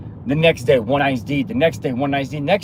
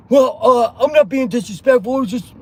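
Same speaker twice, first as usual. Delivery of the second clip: defensive stammer; 8 semitones higher, 5 dB louder than usual. Retold or imitated. Other person defends himself.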